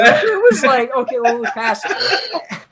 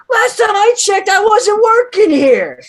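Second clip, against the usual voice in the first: indignant outburst, much higher and louder, slow; 12 semitones higher, 6 dB louder than usual. Retold or imitated. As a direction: huff it out like an offended customer. Retold outrage at buffet.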